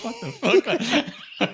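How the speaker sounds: very high, slow, laughing "what the fuck"; bewildered laugh. Retold or imitated. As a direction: drag it out through a laugh. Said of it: "He's just".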